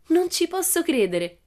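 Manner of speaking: very high, loud, hammed-up disbelief; mock astonishment. Pippi reacting to the teacher's picture, mock astonishment. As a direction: exclaim in pretend amazement. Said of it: "esclamò Pippi".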